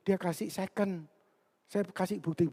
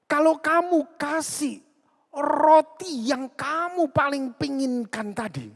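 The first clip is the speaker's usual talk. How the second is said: high-pitched, drawn-out pulpit appeal; preacher's raised, deliberate address; emphatic preaching; 10 semitones higher, 4 dB louder, slower than usual. Acted. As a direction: stretch the key words high as you press the point.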